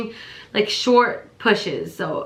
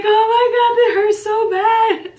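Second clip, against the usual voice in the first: laughing pained cry; 13 semitones higher, 5 dB louder than usual. Retold or imitated. Retold cry of pain.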